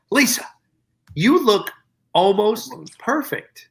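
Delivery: high, slow, deliberate delivery; teasing compliment. Retold or imitated. Man complimenting dolled-up Lisa in elevator, teasingly measured. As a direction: draw out the backhanded praise.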